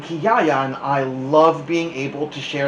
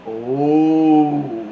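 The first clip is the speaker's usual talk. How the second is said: louder, long drawn-out fearful oh; frightened oh; 7 dB louder than usual. Acted. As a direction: gasp it out in dread. Kids frightened by the news.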